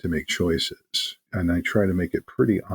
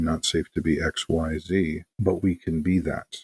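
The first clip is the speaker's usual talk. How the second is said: usual speaking voice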